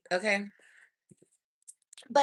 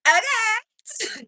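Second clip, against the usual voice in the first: squeaky okay; 14 semitones higher, 6 dB louder than usual. Retold or imitated. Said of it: "And I was like".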